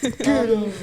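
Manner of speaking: playful high get out; high mock shoo. Mock dismissal after Robin pun.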